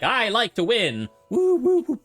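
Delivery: high, loud cocky cheering; shallow trophy husband. Retold or imitated. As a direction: whoop like a show-off winner. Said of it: "he's the trophy husband and is kinda shallow".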